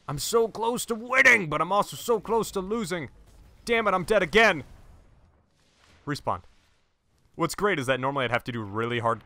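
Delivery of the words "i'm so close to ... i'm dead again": high-pitched exasperated wail; worked-up frustration. Real, not acted. Player dying again near victory, worked-up frustration. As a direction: complain in a raised, agitated pitch.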